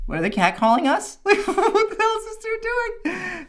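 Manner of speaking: high, incredulous laughing questions; amused bewilderment through laughter; laughing disbelief. Retold or imitated. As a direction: crack up while asking, baffled.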